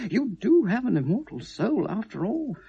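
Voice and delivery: just a hint of God's voice. Rolly imagines God promising him a soul, lightly voiced. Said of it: "God would say".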